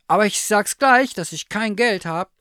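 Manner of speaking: high, loud bear voice; stuffed bear character. A narrator's reading. Bear warns he is penniless.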